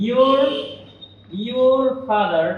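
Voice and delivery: slow, isolated your with a sounded r, then father; R pronounced. Shown as a demonstration. Teacher quoting common usage, drawn-out r in your father. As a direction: linger on your and its r. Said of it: "সাধারণত আমরা বলি".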